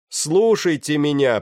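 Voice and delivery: slow, high, loud call for attention; shouted command. A narrator's reading. Pirate to the murmuring deck, shouting for attention. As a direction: shout over the crowd's noise. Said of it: "прокричал человек".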